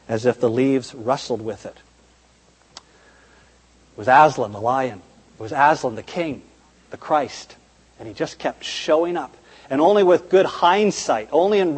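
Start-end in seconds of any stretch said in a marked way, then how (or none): none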